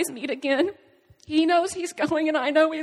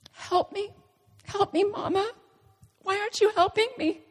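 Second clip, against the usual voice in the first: put-on higher-pitched voice of a child pleading; 8 semitones higher than usual. Choking son silently begging his mother for help, pleading. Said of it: "communicating in our own language".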